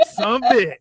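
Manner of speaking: high mock Southern drawl; hammed-up Southern cussing; Southern accent. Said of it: "talk country".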